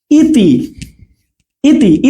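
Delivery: ইতি with a brief opening ই; don't hold the first vowel of ইতি; short ই. Shown as a demonstration. Teacher demonstrating to students, short ই in ইতি. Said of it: "এটা অল্প সময়ের জন্য".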